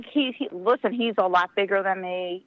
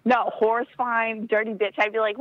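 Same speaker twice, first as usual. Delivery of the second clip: ordinary talk, nothing marked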